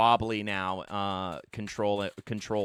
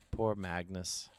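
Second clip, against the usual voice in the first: muttered pity; 3 semitones lower, 8 dB quieter than usual. Shown as a demonstration.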